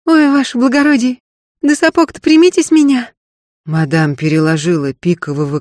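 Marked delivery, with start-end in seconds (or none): 0.1-3.1 put-on high, loud drowsy girl's cry; blurting out half asleep; sleep talking. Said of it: "пробормотала во сне".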